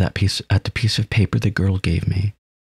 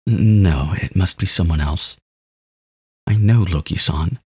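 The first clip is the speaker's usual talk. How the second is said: ordinary delivery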